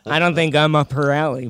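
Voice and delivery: ordinary talk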